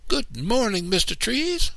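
put-on a girl shouting good morning up at the trees; shouted greeting. A narrator's reading. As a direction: call out gleefully to the trees. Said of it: "I shouted".

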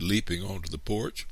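usual speaking voice

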